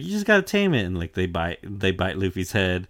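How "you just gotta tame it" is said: breezy Luffy voice. Retold or imitated. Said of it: "It's like".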